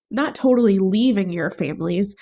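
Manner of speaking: ordinary talk, nothing marked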